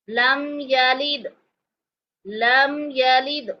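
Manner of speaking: लम यलिद recited slowly, the final द echoing; qalqalah on dal. Shown as a demonstration. Teacher showing the echo on यलिद. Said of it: "यहाँ पर हम कलकला करेंगे".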